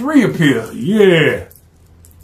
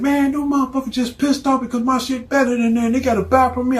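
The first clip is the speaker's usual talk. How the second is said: boastful dealer; 10 semitones higher than usual. Acted. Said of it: "He said".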